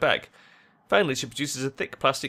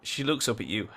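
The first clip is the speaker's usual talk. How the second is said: ordinary talk, nothing marked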